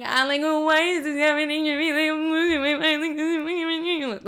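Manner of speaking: high-pitched mock wailing; hammed-up hysterical crying; bawling imitation. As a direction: blubber it out, words smeared together by sobs. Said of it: "I start bawling".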